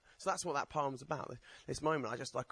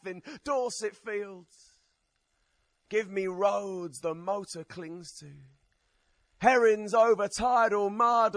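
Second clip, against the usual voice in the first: slow, declaimed verse with raised pitch; poetry recital; 8 semitones higher, 9 dB louder, slower than usual. Acted. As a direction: recite it as an impassioned plea, drawing out each phrase. Recites own poem to audience.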